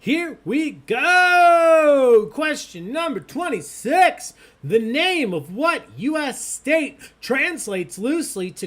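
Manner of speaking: big, high, loud quiz-host call; game-show announcer. Acted. Host launching question twenty-six to the players, announcer hype. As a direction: kick off the round with a booming announcer read.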